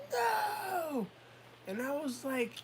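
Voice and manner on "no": high, drawn-out "no"; defiant child cry. Retold or imitated. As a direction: imitate a kid's wailing refusal. Imitates child's defiant yell.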